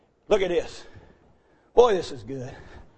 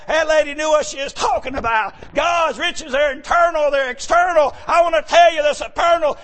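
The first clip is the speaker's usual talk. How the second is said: ordinary delivery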